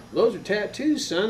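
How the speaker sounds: high Texan mother explaining; put-on high Texas voice; Texas mom accent. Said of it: "She was from Texas and never lost her accent".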